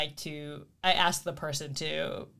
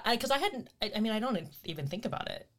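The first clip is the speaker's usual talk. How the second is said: normal talking voice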